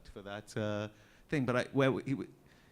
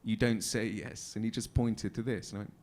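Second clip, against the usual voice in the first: ordinary delivery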